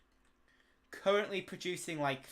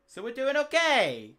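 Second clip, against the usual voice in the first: high, loud burst of glee; excited; 9 semitones higher, 9 dB louder than usual. Real, not acted. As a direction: shout it out happily. Excited outburst.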